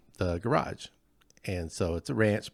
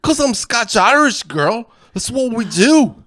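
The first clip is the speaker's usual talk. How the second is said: loud, much higher stage Scots-Irish brogue; cartoon Scottish-Irish accent, pitched up and loud; hammed-up Scottish-Irish accent; 11 semitones higher, 11 dB louder than usual. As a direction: play a gruff Scotch-Irish lout, loud and high. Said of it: "I always wanna be good at accents".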